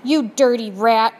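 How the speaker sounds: loud furious insult; angry shout. Acted. Prisoner shouts at the departing rat in fury. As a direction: spit it at him. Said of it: "shouted the prisoner".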